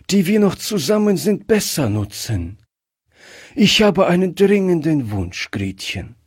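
put-on a dying grandfather calmly comforting his wife and child; sick grandfather. Sick grandfather speaks to family. (kranker Großvater, leicht angedeutet, sanft und höher)

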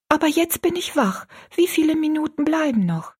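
put-on wide-awake girl. A narrator's reading. Rosalie asks her friend about time, lightly voiced and alert. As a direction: lift it high, a newly alert child.

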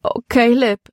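mildly whining distress; wailing. A narrator's reading. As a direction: wail it helplessly to a companion. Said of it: "jammerte Libby".

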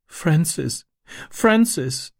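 put-on high, child's sobbing cry of his name, rising; a little girl breaking into tears. A narrator's reading. Sister clings to her brother, sobbing. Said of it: "schluchzte sie".

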